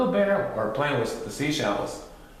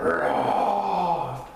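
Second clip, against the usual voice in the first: drawn-out storybook roar; long playful bear roar; mock bear roar; 4 semitones higher than usual. A narrator's reading. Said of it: "Father Bear roared".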